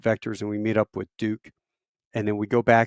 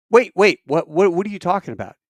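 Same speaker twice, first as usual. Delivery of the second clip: high, loud startled burst; jolted, demanding an explanation; retold panic at hearing they'd be dead; alarmed disbelief; 7 semitones higher, 6 dB louder than usual. Retelling alarm at the pilot's answer.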